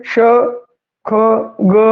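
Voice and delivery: ਸ਼, ਖ and ਗ sounded out one by one; sound demo. Shown as a demonstration. Teacher sounding the new letters for the class. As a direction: isolate each letter sound. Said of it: "ਤਾਂ ਆ ਕੀ ਹੈ".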